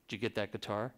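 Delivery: low, soft murmur, imitating [name]; [name]'s voice. Backstage, [name] asking the photographer about the guitar, in a low, soft murmur. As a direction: drop low and quiet like [name]. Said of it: "that's how [name] talks".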